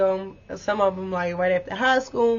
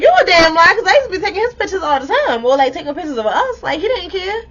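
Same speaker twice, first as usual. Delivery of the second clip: loud, high, fast indignant comeback; retold angry outburst; 7 semitones higher, 7 dB louder than usual. Calling out her ex on the phone, loud and indignant. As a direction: fire back at the lie, heated.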